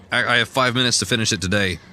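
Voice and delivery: normal talking voice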